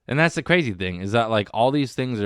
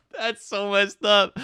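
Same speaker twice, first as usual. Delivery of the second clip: laughing disbelief; 13 semitones higher, much slower than usual. Real, not acted. Laughing at own grim joke.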